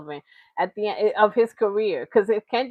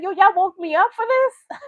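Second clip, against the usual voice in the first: much higher, put-out voice; 8 semitones higher than usual. Retold or imitated. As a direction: act woken up and annoyed. Voicing [name] annoyed at rappers.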